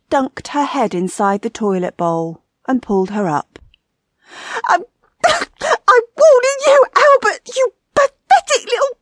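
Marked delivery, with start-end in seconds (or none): 4.6-9.0 very high, loud, choking fury; frantic high-pitched wifely rage; spluttering abuse. A narrator's reading. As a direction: splutter threats while being dunked. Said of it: "her foul-mouthed abuse continued".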